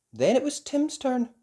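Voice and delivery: usual speaking voice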